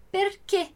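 "perché" with a closed final e, like ê; "perché" pronounced "per-KÊ". Shown as a demonstration. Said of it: "que seria a forma mais correta".

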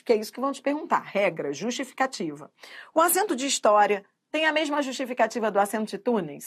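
ordinary delivery

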